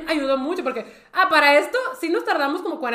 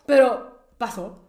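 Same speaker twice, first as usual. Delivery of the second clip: ordinary talk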